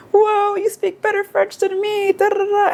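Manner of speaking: very high, drawn-out mock astonishment; hammed-up high-pitched wow reaction; imitated amazement. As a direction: gush slowly way up high, mimicking someone impressed. Imitates impressed French speakers' reaction.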